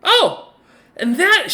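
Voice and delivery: high, loud exclamation of recognition; surprised Rain. Acted. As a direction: pitch it way up, caught mid-act. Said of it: "kinda looks over and goes".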